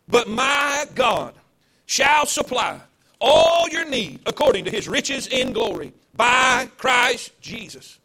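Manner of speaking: slow, loud, drawn-out Bible reading; a preacher's solemn, loud verse reading; scripture proclamation. Acted. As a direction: proclaim each word of the verse. Reads the verse aloud.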